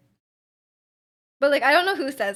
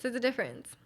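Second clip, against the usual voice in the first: softer echo; 7 dB quieter than usual. Shown as a demonstration. Echoing her point to viewers, softly. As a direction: repeat There's a difference softer, as an afterthought.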